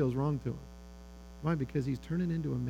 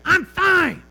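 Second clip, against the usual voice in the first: put-on loud, high, irritated boy's shout; high-pitched full-force holler; yelling back; 13 semitones higher, 12 dB louder than usual. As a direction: yell it back sharply across the field. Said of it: "And he yells back".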